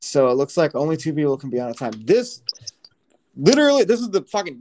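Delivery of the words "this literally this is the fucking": high exasperated outburst. Real, not acted. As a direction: vent the frustration loudly. Frustrated at game limit.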